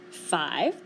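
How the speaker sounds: low, puzzled storybook bear; a touch of bafflement. A narrator's reading. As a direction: ask it as a befuddled bear.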